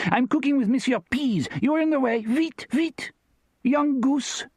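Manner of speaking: put-on high, French-accented and emphatic; emphatic French woman. A narrator's reading. Therese sending Jake away, emphatic French accent. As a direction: shoo him off in a French accent. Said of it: "she added".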